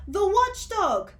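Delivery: high, loud, slow panicked shout; terrified shout. Acted. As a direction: scream out the warning. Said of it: "shouted another".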